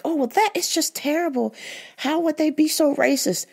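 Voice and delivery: high, sugary fake concern; imitating the hypocrite lady's show of sympathy, pitched high; mock sympathetic lady. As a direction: play up her phony outrage at racism. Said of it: "And she was like".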